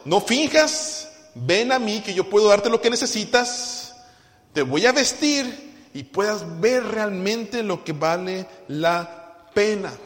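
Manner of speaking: slow, loud, high pleading as God. Acted. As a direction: plead with them slowly, as God calling. Dios habla al creyente. (Dios llamando al creyente, lento y suplicante)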